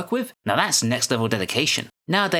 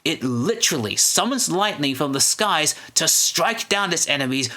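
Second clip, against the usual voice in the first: building to a booming Pulp Fiction shout; sudden loud outburst; 3 semitones higher than usual. Acted. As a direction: preach it louder and louder to a roar. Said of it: "apologize for my little outburst there".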